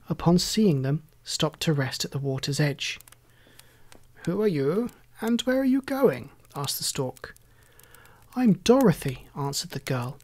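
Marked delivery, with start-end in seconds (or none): 4.2-6.3 a hint of a higher, curious bird voice; lightly voiced stork. A narrator's reading. Stork questioning the strange party at the water's edge, lightly higher bird voice. 8.3-9.3 put-on higher, bright girl's voice; lightly voiced little girl. A narrator's reading. Dorothy introducing herself to the stork, lightly higher girl voice.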